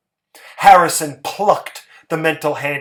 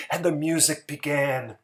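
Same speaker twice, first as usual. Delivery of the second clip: ordinary delivery, nothing marked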